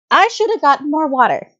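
high, drawn wail falling at the end; regretful wail. Acted. Character regrets running out of water.

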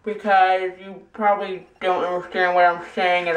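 talking while brushing teeth; toothbrush in mouth. Real, not acted. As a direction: mouth full of toothpaste foam. Seen 0: Toothbrush in mouth, actively brushing throughout; absent before and after.

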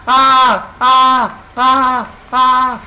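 repeated high, loud "ah" screams; excited screams. Real, not acted. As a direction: scream in short, excited bursts. Screams over the discovery.

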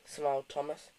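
ordinary delivery

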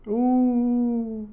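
very high squealing ooh then airy laugh; swooning reaction to the smell; high delighted ooh and laugh. Real, not acted.

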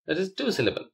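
ordinary talk

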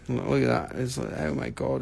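ordinary talk

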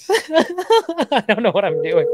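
high, loud words through laughter; laughing. Real, not acted. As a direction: crack up at your own attempt. Cracks up at own Spanish.

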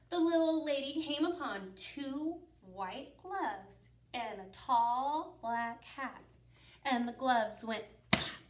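usual speaking voice

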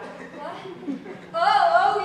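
loud hammed-up gasping into contractions; mock labor pains. Pregnant woman fakes contractions to send someone off, loudly hammed up. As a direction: fake going into labor, big and loud.

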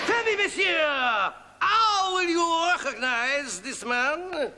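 slow, hammed-up French accent; very marked French accent. Fugitive questions the Sergeant, very marked French accent. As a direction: lay the French on thick. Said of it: "He has a very marked French accent".

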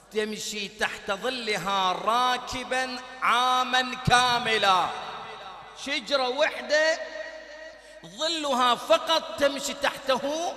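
slow, loud, drawn-out proclamation; preaching at full force. Real, not acted. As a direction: proclaim the wonder to the hall. يقرأ الحديث بصوت خطابي عالٍ. (إلقاء خطابي عالٍ ومتمهل)

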